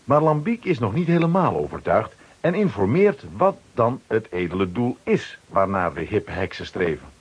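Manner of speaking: usual speaking voice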